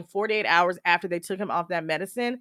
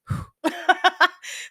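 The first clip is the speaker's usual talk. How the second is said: heavy sigh; 11 semitones higher than usual. Real, not acted. Sigh after the upset aside.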